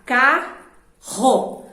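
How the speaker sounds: "carro" loudly split as ca... ro; spaced-out "carro", both syllables firm; "carro" in two loud, separate beats. Shown as a demonstration. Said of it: "Posso colocar".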